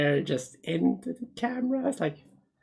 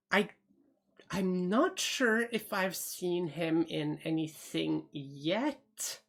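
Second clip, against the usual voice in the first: usual speaking voice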